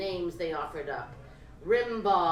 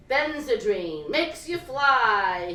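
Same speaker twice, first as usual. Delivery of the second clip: grinning; 3 semitones higher, 8 dB louder, slower than usual. A narrator's reading. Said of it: "he grinned".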